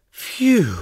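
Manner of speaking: loud high phew; relieved phew. A narrator's reading. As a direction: blow out relief.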